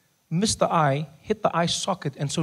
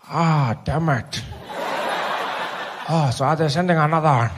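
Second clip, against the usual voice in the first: Nelson Mandela. Acted. Old leader hearing the ambulance is stuck, weary.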